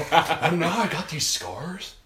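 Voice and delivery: slow, loud, high villain voice; Heath Ledger's Joker. Acted. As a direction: taunt like a cackling movie villain. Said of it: "Heath Ledger?".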